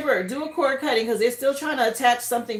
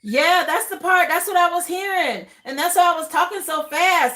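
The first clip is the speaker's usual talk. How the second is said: higher, louder excited recounting; 5 semitones higher, 4 dB louder than usual. Real, not acted. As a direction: rush it out, thrilled. Excited about the reading.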